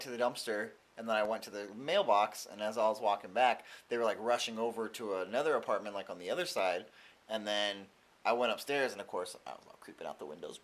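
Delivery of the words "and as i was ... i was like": ordinary talk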